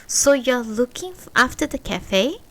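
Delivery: ordinary delivery, nothing marked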